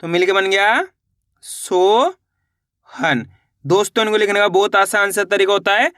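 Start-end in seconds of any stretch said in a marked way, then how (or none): 1.4-3.3 Sohan drawn out very slowly to show its sounds; slow phonics sounding out of Sohan; stretched out slowly. Shown as a demonstration. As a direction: draw Sohan out like a teacher sounding it. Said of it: "यहाँ पे ना गया".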